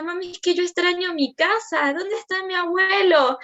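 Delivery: lightly voiced child missing home; child's voice. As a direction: imitate her small son asking for his grandpa. Voicing her young son.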